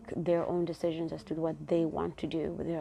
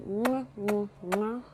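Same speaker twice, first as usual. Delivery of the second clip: three quick smacking air kisses; blown kisses; 2 semitones higher, slower than usual. Real, not acted. Host signing off to listeners with smacking blown kisses.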